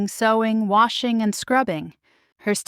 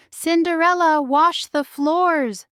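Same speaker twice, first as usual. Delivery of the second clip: high, slow, commanding order; stern order; 9 semitones higher, 4 dB louder than usual. A narrator's reading. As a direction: bark the chore as a command. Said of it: "her stepmother ordered".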